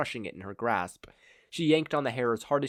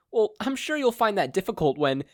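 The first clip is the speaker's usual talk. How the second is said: smug; 10 semitones higher, 5 dB louder than usual. A narrator's reading. Hero smirking confidently.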